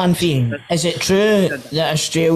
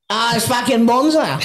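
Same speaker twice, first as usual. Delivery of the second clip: higher, hammed-up Australian voice; Australian accent; 6 semitones higher than usual. Host jokes with the caller, higher hammed-up Australian accent. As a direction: ham up the Aussie slang, pitched up. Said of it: "do your Australian accent".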